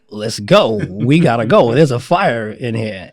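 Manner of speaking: loud, urgent wake-up call; retold firefighter yelling at a sleeper. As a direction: shout at him to get up now.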